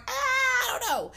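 very high, drawn-out squeal into words; high-pitched mock dismay. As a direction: squeal it slowly in comic dismay. Acts out her dismayed reaction.

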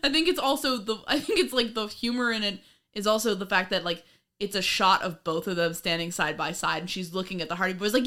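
usual speaking voice

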